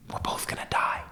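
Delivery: hushed whisper, breath only. Retold or imitated. Scorpion reassuring the frog, in a whisper. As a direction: drop to a secret whisper.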